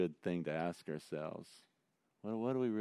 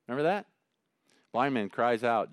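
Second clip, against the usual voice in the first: usual speaking voice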